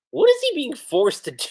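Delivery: high-pitched, mock mortified question; hammed-up flustered squeal of a question. Urza reacting to him getting close, flustered. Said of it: "just how flustered Urza gets".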